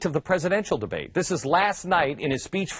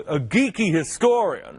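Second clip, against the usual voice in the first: ordinary talk, nothing marked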